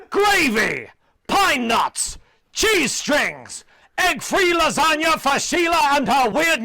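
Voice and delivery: booming TV announcer voice, slow and loud; Peter Dixon. Pretend. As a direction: bellow each item like a show host. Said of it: "I myself will play Peter Dixon".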